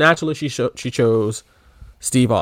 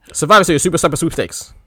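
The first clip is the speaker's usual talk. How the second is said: quick, higher run through the whole title; fast tongue twister; 4 semitones higher, faster than usual. Shown as a demonstration. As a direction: rattle it off without a break. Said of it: "Say that five times fast".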